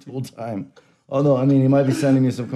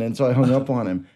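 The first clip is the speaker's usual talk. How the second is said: ordinary talk, nothing marked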